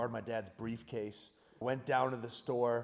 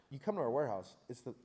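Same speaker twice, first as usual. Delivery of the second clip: normal talking voice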